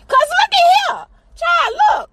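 high, loud call for attention; high exclaim. Real, not acted. Mom grabbing her listeners' attention, high and loud. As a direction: cry out to grab them. Comic emphasis.

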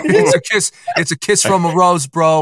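put-on a light Boston accent, high start; light Boston bro voice. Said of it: "You're using that Boston accent".

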